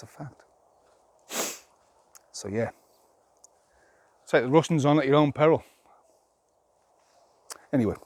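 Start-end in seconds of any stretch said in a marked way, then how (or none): 4.3-5.6 loud warning. Real, not acted. Warning viewers about Russia.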